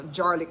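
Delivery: "garlic" starting with a J sound, like jar; garlic as jarlic. Shown as a demonstration. Explaining her nickname for jarred garlic, saying "garlic" as jarlic. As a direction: swap the G of "garlic" for a J. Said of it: "in the jar".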